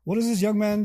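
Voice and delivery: normal talking voice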